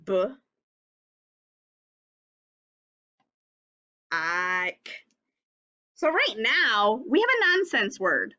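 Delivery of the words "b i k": "B. I. K." with long gaps between sounds; sounding it out. Shown as a demonstration. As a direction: sound out bike slowly, holding the I. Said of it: "sounding it out".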